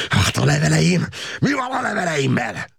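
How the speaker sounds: high-pitched yell full of hatred; hateful shout. A narrator's reading. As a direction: scream the question at the officer. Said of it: "sziszegő gyűlölettel kiáltotta".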